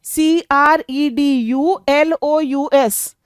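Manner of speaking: letters of सीआरईडीयूएलओयूएस spelled slowly one by one. Shown as a demonstration. Teacher to students, spelling सीआरईडीयूएलओयूएस slowly. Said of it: "फिर है यहाँ पर क्रेड्यूलस".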